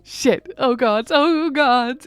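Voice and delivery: loud, rising panicked cries; panicked outburst. Acted. Ghost panicking over his own death, loud frightened cries. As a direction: blurt it out in horror.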